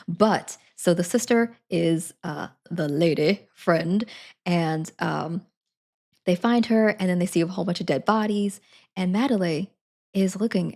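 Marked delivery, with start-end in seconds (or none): none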